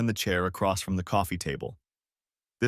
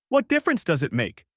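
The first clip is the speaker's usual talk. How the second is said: shouting; 9 semitones higher, 4 dB louder than usual. A narrator's reading. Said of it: "I shouted".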